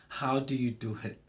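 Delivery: normal talking voice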